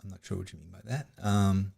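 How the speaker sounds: ordinary talk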